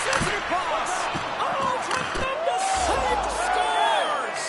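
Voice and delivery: very high, drawn-out excited call; excited shout. Real, not acted. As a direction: scream the goal call. Climactic save and goal call.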